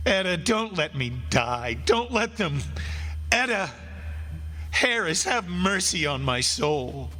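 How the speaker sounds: high anguished begging, falling away at the end; praying in mortal terror; desperate plea. Acted. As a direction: beg the dead to save your life. Condemned man begs the dead.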